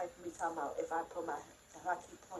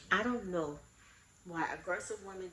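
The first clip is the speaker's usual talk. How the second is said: ordinary delivery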